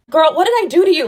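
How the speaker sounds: exasperated plea to the customer; incredulous and fed up. Real, not acted.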